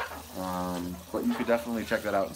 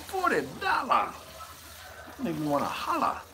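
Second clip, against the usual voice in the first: shocked disbelief; 7 semitones higher than usual. Real, not acted. Vlogger reacting to the shipping price, shocked high squeal. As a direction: pitch jumps way up, outraged at the price.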